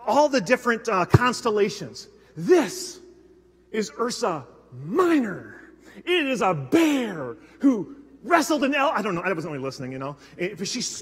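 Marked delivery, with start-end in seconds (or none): 2.4-8.9 slow, drawn-out tour-guide voice; hint of a passionate volunteer; mimicking the guide. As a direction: lightly imitate an earnest star guide. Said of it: "real casually".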